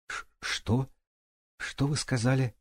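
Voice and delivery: растерянно проблеять, выше и тише; сбивчиво, тихо, на высокой ноте; блеющий голос. A narrator's reading. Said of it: "проблеял он". (ошеломлённо блеет, тихо и высоко)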